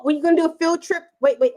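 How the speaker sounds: usual speaking voice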